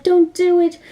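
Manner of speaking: lightly acted frightened warning; retold fear. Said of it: "Mitsuko is so scared".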